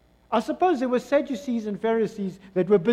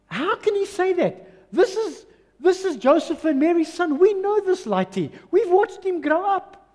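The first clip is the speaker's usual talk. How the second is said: high-pitched mock disbelief; incredulous; 8 semitones higher than usual. Townsfolk doubting Joseph and Mary's son, incredulous. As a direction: play the indignant onlooker, pitched up. Acted crowd disbelieving Jesus' claim.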